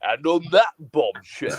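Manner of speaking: presenter's sign-off, stress on THAT; Jeremy Clarkson. Acted. Host redoing his sign-off for co-host, mock presenter. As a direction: land it like a TV car-show host. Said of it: "my Jeremy Clarkson at the end".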